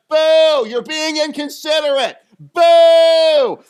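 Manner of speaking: high, loud mocking boo and scold; jeering boos. Pastor booing the cutters ahead in line, loud jeer. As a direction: jeer at them full voice. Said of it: "they look back and went".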